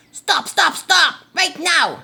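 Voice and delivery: high, loud screech of stop; screeching bird. Acted. Myna bird screeching at the musubi man. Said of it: "screeched the noisy myna bird".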